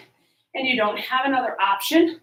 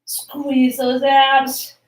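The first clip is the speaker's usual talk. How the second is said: aerobics instructor. Acted. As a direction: pump up the class on the count-in. Instructor cueing with rising energy.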